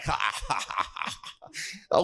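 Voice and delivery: mock corporate chuckle; imitated chuckle. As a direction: fake a dismissive ha-ha laugh. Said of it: "he just chuckles".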